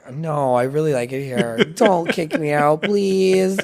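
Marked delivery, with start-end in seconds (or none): 1.6-3.6 slow, stretched mock begging; hammed-up begging; playful pleading. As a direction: plead in jest, rising higher on the last word.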